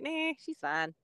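dismissive coworkers. Pretend. Guest voices coworkers watching her in the car, mock-dismissive. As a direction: imitate onlookers shrugging it off with a drawn "nah". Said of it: "They're probably like".

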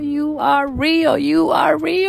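rising from awed to high and loud, slowed on "real"; overjoyed prayer. Retold or imitated. Woman on her knees praising God for her son, ecstatic. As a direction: cry out to God in disbelieving joy, building. Kneeling, thanking God for pregnancy.

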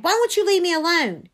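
high, loud outburst; upset, fed-up shout; exasperated cry. A narrator's reading. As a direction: cry out at a pest who follows you. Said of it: "Whirling, I cried".

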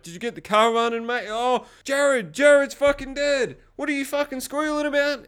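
high, agitated character voice; imitated Heath. Heath comes up to find Jared dead, imitated high and agitated. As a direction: push it high, rising into alarm.

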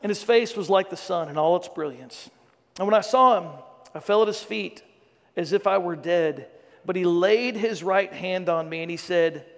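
solemn, measured reading of Revelation; scripture reading. Acted. Pastor reading Revelation to the church, solemn scripture reading. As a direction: read it out like the Word to the congregation.

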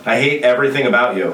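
mildly exasperated retold complaint; lightly acted gripe at a trainer. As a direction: grumble it at the coach, only half in earnest. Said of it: "I'm like".

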